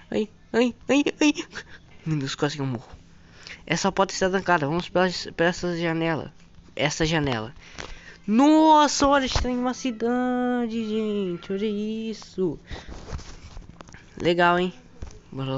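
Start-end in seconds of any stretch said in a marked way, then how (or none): 0.1-1.3 rising, quick "ai" yelps; alarmed little cries climbing in pitch; panicked yelps. Real, not acted. Nearly dies in the game. 8.3-12.6 high, drawn-out wow; stretched cry of amazement at the view; amazed exclamation. Real, not acted.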